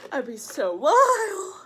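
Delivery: high, loud, drawn-out exclamation; hysterical whoop. Real, not acted. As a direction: whoop it slowly at your own joke. Cracking up at own joke.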